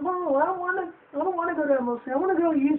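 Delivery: put-on high squeaky whining child; whiny girl. Girl begging to go along, high whiny voice. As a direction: whine it high and fast. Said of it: "She kept whining and bitching about it".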